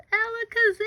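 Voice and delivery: high, loud burst of the spell; magic spell. Shown as a demonstration. Teacher casting the magic E spell with flair, high and loud. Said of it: "but not anymore".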